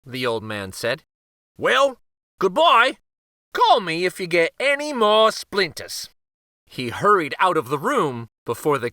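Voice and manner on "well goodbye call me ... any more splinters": high, louder cheerful farewell; cheery uncle. A narrator's reading. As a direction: wave goodbye brightly. Uncle leaves.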